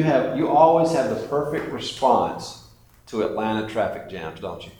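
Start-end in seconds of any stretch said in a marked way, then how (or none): none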